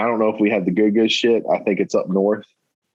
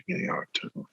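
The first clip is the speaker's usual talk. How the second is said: whispered; 13 dB quieter, faster than usual. Real, not acted. Muttering the date back to himself.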